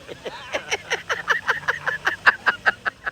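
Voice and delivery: high wheezing laugh; long, helpless stuttering laughter; real laughing fit. Real, not acted. Joke teller after the Kevin Hart punchline, laughing helplessly.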